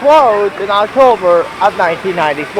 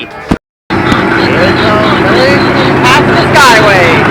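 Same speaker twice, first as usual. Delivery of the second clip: excited; 6 dB louder than usual. Real, not acted. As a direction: announce it like a big reveal. Sneaking past the building.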